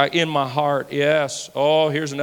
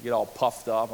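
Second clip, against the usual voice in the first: ordinary delivery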